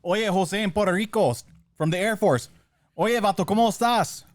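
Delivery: put-on high, loud Mexican-accented hey-buddy call; hammed-up Mexican accent camaraderie. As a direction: greet him like a fellow Mexican airman, in a Mexican accent.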